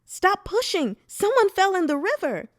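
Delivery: high, loud cry of alarm; piercing scream. A narrator's reading. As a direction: scream a warning to the crowd. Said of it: "let out a piercing scream".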